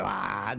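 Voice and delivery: low growling roar in the throat; dinosaur roar. Retold or imitated. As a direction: rumble it like a big lion. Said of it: "they make that".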